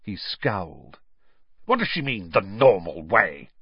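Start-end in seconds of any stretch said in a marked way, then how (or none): 1.6-3.5 spat. Acted. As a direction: spit out the words with scorn. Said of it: "he spat".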